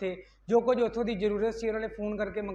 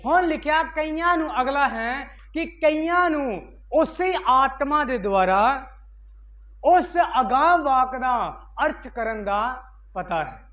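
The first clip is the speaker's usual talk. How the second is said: loud, raised preacher's call; fiery preaching; 3 semitones higher, 6 dB louder, slower than usual. Acted. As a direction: proclaim it to the whole church. Preaching at full force. (ਜੋਸ਼ੀਲਾ ਉੱਚਾ ਪ੍ਰਚਾਰ)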